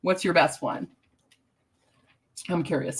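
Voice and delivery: quiet words between sips of tea; sipping tea. Real, not acted. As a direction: talk softly while sipping tea. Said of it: "I'm sipping my tea right now".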